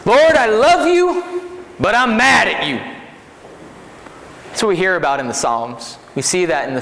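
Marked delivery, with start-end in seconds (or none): 0.1-2.8 much higher, slow, drawn-out cry to the Lord; imitating Sonny's plea. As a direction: stretch "Lord" out like a preacher pleading with God. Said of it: "I just love that line".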